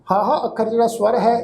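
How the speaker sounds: ordinary talk, nothing marked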